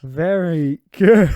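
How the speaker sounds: slow, loud and drawn out; loud drawn-out approval. Acted. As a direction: stretch it out like a proud host. Louder repeat of catchphrase.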